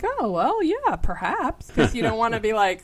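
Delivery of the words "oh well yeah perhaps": high, slow, airy "perhaps" to sidestep; noncommittal polite dodge. Retold or imitated. Dodging a political argument with a much higher, slow noncommittal perhaps. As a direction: float it up high and slow, avoiding the fight. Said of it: "you could be like".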